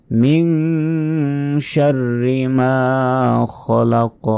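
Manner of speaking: sung Quran recitation. Shown as a demonstration. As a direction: recite the line like tilawat. Model recitation.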